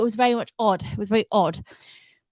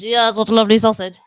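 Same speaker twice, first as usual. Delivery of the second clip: put-on voice; 7 dB louder than usual. Repeated in a put-on voice.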